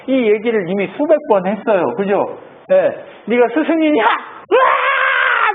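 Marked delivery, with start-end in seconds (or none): 3.3-5.5 high, stretched-out scream of rage; furious yell. Retold or imitated. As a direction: scream it out in frustration. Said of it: "막 이렇게 소리 질렀겠죠". (분노에 차서 높고 길게 소리 지르며)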